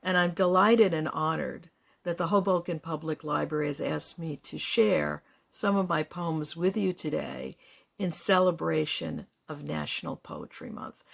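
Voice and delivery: ordinary talk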